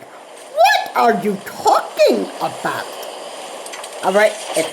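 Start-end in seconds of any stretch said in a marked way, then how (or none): 0.0-2.9 higher, drawn-out mock clueless voice; playing dumb. Annoyed chatter mimicking people who pretend to be stupid, mock cluelessness. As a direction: imitate someone pretending not to understand. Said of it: "when they pretend, like".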